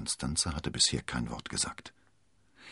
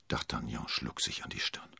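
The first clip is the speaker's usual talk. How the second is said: plain reading voice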